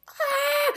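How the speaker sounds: high, thin panicked scream; panicked mock scream. Mother retelling finding her oldest son gone, panicked scream.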